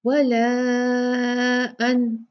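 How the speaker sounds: very slow chanted tajweed recitation; Quranic recitation. Shown as a demonstration. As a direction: recite it, drawing out the madd. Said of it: "ऊपर नीचे ये दोनों same है". (धीमी तजवीद वाली तिलावत)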